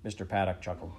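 ordinary delivery

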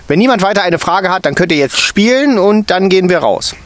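high, loud and bossy; teacher ending question time; stern teacher. A narrator's reading. Stern teacher dismissing the children to play.

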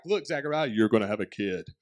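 hammed-up East Tennessee angel; Southern accent. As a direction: put on a low Southern country-boy voice. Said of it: "Southern accent, East Tennessee boy".

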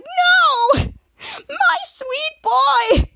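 very high, loud mock wail; slow, hammed-up grief, stretching every word; anguished wail. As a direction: cry out melodramatically over a fallen pet. Anguished cry over fallen monster.

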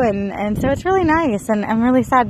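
ordinary talk

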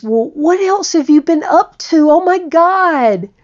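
high and loud, gushing; excited. Retold or imitated. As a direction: pour it out like an old friend. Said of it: "And he goes".

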